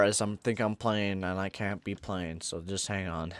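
ordinary talk

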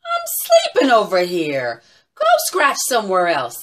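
loud hoots dropping into a scolding; loud, annoyed owl; cross owl. Acted. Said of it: "said Owl".

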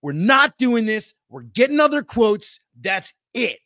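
angry husband. Retold or imitated. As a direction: put your foot down as the husband. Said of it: "he slams his hand down on the table".

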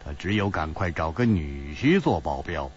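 low, understated explanation; cool, faint smile. A narrator's reading. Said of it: "淡淡一笑说".

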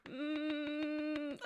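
long, soft held hum; stifled closed-mouth whine; tearful whimper. Real, not acted. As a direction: hold back tears in a quiet "mm". Holding back tears.

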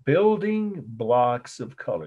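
slow, deliberate term announcement; slow dictation. Shown as a demonstration. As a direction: dictate it slowly for note-takers. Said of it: "I will say it slowly".

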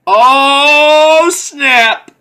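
high, loud burst of excitement; excited exclamation. Real, not acted. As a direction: blurt it out, thrilled. Excited reaction to game news.

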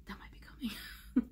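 breathy whispered tease; whispered musing. Real, not acted. Vlogger hinting at an upcoming look, whispered. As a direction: whisper it half to yourself.

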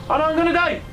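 high-pitched comic cry of alarm; mock panic. Gamer jumping off in game, in mock panic. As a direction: panic playfully, voice shooting up.

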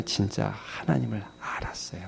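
low, breath-only near-whisper; hushed conviction. Real, not acted. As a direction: drop to a reverent hush. Hushed, weighty point about Moses. (속삭이듯 낮고 조용한 확신)

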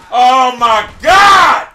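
loud, drawn-out cry; exasperated shout. Real, not acted. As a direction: yell it slowly in exasperation. Frustrated at the game.

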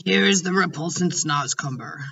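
usual speaking voice

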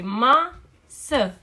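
"masă" as MA, pause, SĂ; slow, separate beats on "masă"; split into syllables. Shown as a demonstration. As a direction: break "masă" after ma. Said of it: "silabă la început".

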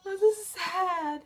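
high-pitched sad whine; about to cry. Real, not acted. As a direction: lament as if tears are coming. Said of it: "Now I'm gonna cry".

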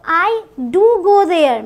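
"do" and "go" hit loud and slow; emphatic do. Shown as a demonstration. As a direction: insist firmly, stretched out. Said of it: "जोर देकर बोला". ("do" पर ज़ोर देकर धीरे-धीरे)

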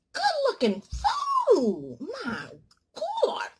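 high, very slow drawn-out exclaim; gushing over the food; excited. Real, not acted. Raving about posted food.

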